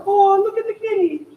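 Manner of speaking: high, projected coo; high-pitched kitten-admiring call; high head voice. Shown as a demonstration. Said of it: "higher stuff is a little more in your head".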